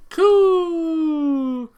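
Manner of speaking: loud, high pigeon coo. Acted. Pigeon Oko-san cries out.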